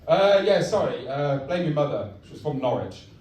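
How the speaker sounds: low, lightly voiced sheepish dad; mumbled-off apology, dropping in level; Darth Vader. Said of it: "I'm your dad, Darth Vader".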